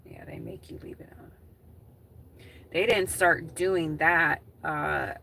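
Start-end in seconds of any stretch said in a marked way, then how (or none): 0.1-1.3 whispered aside; breathy unvoiced whisper. Real, not acted.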